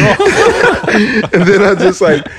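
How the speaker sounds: high, slow words through laughter; helpless laughter stretching the words; real laughing. Real, not acted. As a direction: crack up while telling it. Laughing at having hung up.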